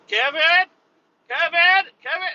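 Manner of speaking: high-pitched imitation of a server calling; mock calling out. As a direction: call the name out high, searching for someone. Server calls out for customer.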